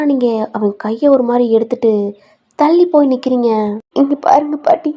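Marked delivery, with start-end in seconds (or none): 4.0-4.9 high crying voice, halting; acted tearful plea. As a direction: beg grandmother through tears, with long breaks. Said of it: "அழுகுறீங்க". (அழுதுகொண்டே விட்டு விட்டு கெஞ்சுவது)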